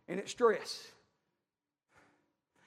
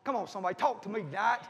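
ordinary delivery

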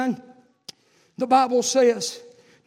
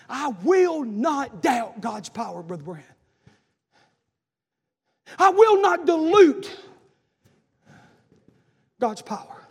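slow, declared vow; slow vow from the pulpit; emphatic preaching; 4 dB louder, slower than usual. Acted. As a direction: preach each word slowly. Preaching at full force.

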